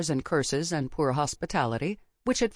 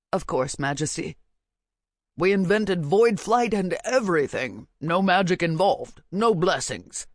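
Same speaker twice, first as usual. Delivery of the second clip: sarcastic vizier; 4 dB louder than usual. A narrator's reading. Vizier mocks king's boast.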